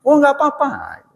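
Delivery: smooth it over graciously; polite reassurance. Retold or imitated. Host's polite reply.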